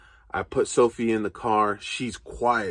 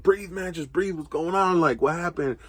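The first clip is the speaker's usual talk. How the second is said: much higher, urgent voice; anxious friend telling him to breathe; friend calming him; 8 semitones higher than usual. Retold or imitated. Friend Travis on the phone, higher and urgent. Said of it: "Like, Travis like".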